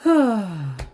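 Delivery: loud, real exhale; long audible sigh; heavy sigh. Real, not acted. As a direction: let out a big weary sigh. Pauses while venting about struggles.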